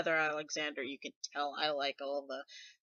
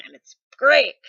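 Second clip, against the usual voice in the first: excited; 7 semitones higher, 20 dB louder than usual. Real, not acted. Gleeful about Frollo getting whacked.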